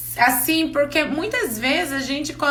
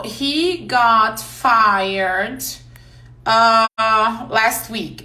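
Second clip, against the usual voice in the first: very slow, every word of "Fire can be fire" stretched; slow teaching example; much slower than usual. Shown as a demonstration. Teacher slows way down to explain "fire" to the class. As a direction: spell out "Fire can be fire" slowly for learners. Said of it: "fire também significa demitir".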